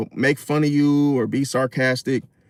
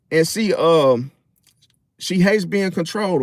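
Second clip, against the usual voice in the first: ordinary talk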